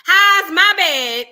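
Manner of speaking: loud, very slow "my bad"; drawn-out mock apology. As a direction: stretch it out playfully. Playful exaggerated apology.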